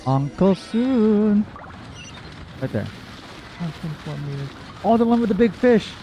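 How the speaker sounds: ordinary delivery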